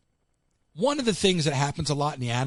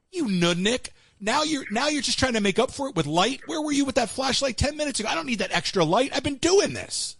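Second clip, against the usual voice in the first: mock exasperated rant; 9 semitones higher than usual. Retelling how he scolded the coworker with a flashlight, mock exasperated. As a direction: scoff at him for the late help. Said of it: "I'm like".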